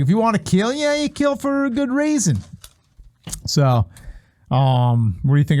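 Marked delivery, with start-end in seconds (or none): 0.0-2.4 Chopper Reed. Retold or imitated. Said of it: "He's like".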